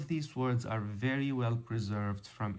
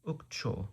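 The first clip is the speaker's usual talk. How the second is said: "Ukcho" repeated softly, stress on cho; soft model of "Ukcho", weight on the final syllable; 5 dB quieter than usual. Shown as a demonstration. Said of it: "With a stress on the final syllable".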